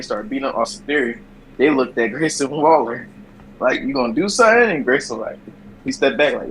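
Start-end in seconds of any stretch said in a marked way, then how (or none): 3.8-4.7 challenging. Retold or imitated. Wrestlers confronting Grayson.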